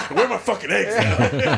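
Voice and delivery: [name] angry. Retold or imitated. Imagining [name] at breakfast, mock angry and laughing. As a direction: throw a fake breakfast fit.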